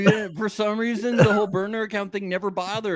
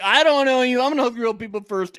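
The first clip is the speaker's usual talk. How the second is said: brisk dismissal; 6 semitones higher, 4 dB louder than usual. Retold or imitated. Voices Kay's brisk entrance.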